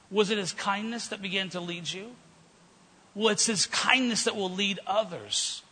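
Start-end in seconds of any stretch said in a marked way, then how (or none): none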